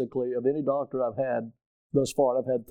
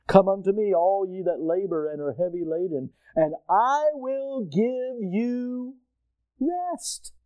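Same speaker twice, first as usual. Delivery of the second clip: tender invitation; 3 semitones higher than usual. Retold or imitated. Preacher quoting Jesus's invitation.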